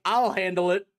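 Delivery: a hint of a pompous wizard bragging; smug Naugus. Recapping the comic, Naugus brags in a lightly put-on voice. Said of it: "Naugus is like".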